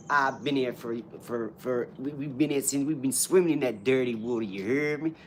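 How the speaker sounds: mock New Orleans street American; hammed-up American with a repeated tag; American accent. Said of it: "I've got a better one, ready?".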